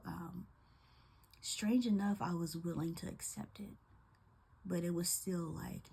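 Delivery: ordinary talk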